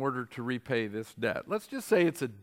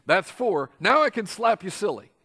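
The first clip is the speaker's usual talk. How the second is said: gleeful threat; 4 semitones higher, 6 dB louder than usual. Pretend. Mock punchline after counting.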